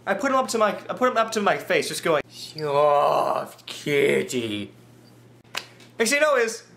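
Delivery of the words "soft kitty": cooing to kitty. Retold or imitated. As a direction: croon it gently to a fluffy cat. Cooing to new cat.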